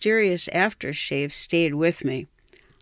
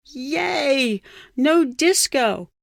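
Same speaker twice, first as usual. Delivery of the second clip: delighted cheer; 8 semitones higher, much slower than usual. A narrator's reading. Narrator cheers rock over disco.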